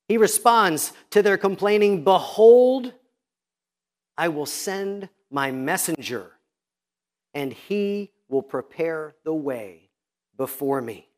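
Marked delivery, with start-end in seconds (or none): 2.0-2.9 booming proclamation. Acted. God's proclamation opening. 4.2-11.1 slow hushed reading. Acted. Solemn quote after booming Behold.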